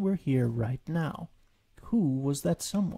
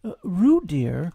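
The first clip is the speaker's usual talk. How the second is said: put-on high, loud mother calling her child; motherly call; 4 semitones higher, 7 dB louder, slower than usual. A narrator's reading. Kanga calls down to Roo in the hole, motherly. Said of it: "called a motherly voice".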